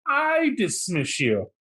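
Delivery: imitating Tom. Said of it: "you've heard Tom".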